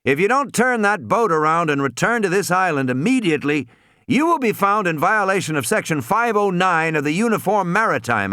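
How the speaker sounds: forceful, formal ultimatum over the radio; stern official threat. A narrator's reading. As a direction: reel off penalties with authority. Gennaro threatens captain with the law.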